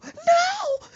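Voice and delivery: very high, loud mock scream; panicked scream. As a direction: shriek in horror as the mama. Mama's panic over pet bird.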